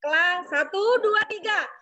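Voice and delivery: cheerleader count-in. Real, not acted. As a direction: count them in, then stretch the cheer long. Group cheer led by instructor.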